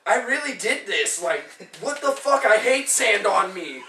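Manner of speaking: retold exasperated rant, rising in pitch; mad outburst. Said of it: "I got so mad".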